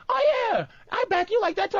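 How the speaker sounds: high mock girlfriend voice; a hint of a sweet girlfriend; mimicking girlfriend. Quoting his girlfriend backing him up, high mock voice. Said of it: "and she goes".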